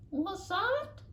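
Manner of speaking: high, light squirrel voice; a small dazed creature asks; squirrel's question. Acted. Squirrel answers voice.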